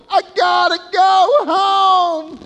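high, loud, slow cry of going home; urgent cry. Retold or imitated. The prodigal son deciding to return, loud slow cry. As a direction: call it out, drawn out and desperate. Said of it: "He's going".

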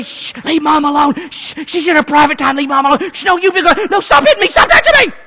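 high, loud hushing turning squabbling; children whispering then fighting; high kids' voices, hushing turning into a fight; kids at the door. Retold or imitated. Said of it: "And they'll say".

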